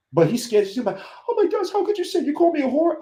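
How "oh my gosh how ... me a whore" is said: high, hammed-up offended squeal; mock offended guy. Scared guy voiced, offended.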